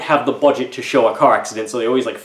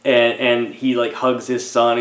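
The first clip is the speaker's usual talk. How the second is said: normal talking voice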